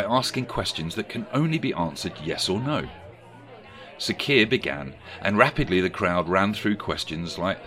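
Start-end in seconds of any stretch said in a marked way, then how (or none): none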